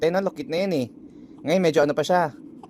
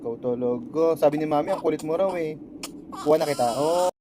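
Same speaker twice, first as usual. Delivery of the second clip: usual speaking voice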